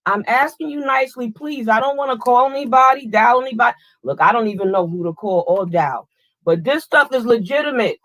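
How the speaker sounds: polite plea building to irritation; from nicely asking to snapping; calm turning angry. Acted. Scolding a parked car.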